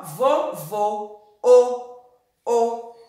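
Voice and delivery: "vovô" slow and loud, then a rounded closed "o"; "vovô", "o" with tight closed ô; closed vowel. Shown as a demonstration. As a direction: close the o in "vovô" and repeat "o" alone. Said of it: "o som do O é diferente".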